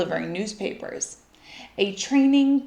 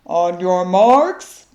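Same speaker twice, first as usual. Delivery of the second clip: slow, loud coach's call; bellowing coach; 2 semitones lower, 8 dB louder, much slower than usual. Acted. Coach starts the race, slow loud bellow. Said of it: "bellowed the coach".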